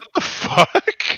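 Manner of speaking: high, drawn-out incredulous squeal with a laugh; squeaky, stretched mock shock; retold disbelief. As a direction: laugh through a baffled outburst. Retold baffled reaction, laughing.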